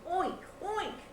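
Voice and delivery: high-pitched pig oinks; two short, high piggy grunts. A narrator's reading. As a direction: oink twice like a storybook pig. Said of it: "grunted the pig".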